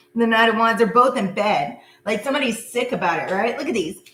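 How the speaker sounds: ordinary talk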